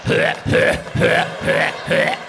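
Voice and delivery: breathy scraping sound of litter; unvoiced scratch-scratch noise; imitated scratching noise. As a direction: mimic a cat digging in the box. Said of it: "isn't that the best noise on the planet".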